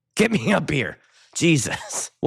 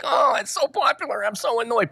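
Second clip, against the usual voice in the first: higher, whiny put-on voice; whiny mock self; 10 semitones higher than usual. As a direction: whine like a spoiled brat about fame. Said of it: "acting like a little bitch here".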